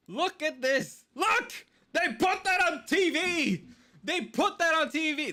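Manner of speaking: high, loud, worked-up outburst; outraged showing. Real, not acted. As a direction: jab at the screen in disbelief.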